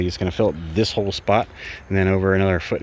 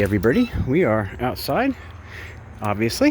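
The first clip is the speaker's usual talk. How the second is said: ordinary delivery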